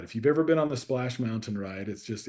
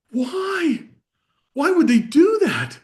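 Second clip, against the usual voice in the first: put-on much higher and louder, wide-eyed little girl; daughter's shocked question; 9 dB louder, slower than usual. Dad voicing his daughter's disbelief about Disney, high and loud. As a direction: squeak it out like a stunned child. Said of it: "and she goes".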